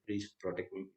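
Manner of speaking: soft, low pleading plea; begging for protection. Acted. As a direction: beg at his feet for your life. Kali begs at the king's feet.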